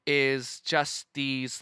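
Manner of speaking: ordinary talk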